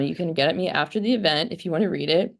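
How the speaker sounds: usual speaking voice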